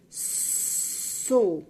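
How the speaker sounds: "so" with a long hissed S, then a loud O; blending letter sounds. Shown as a demonstration. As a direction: slide from sustained sss into "so". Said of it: "S, o O vai ficar".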